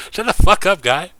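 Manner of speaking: ordinary talk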